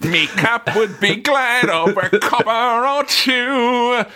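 high, slow hammed-up Cockney; mock Cockney. Quoting Bert from the film, hammed-up Cockney. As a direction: ham up a music-hall Cockney.

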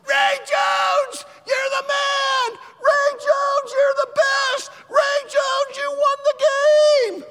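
full-voice fan shouting, very high and loud; yelling. Retold or imitated. Standing on the stadium seats cheering the hero, yelling. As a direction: holler each phrase in wild celebration. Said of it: "And I'm yelling".

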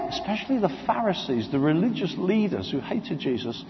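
ordinary delivery

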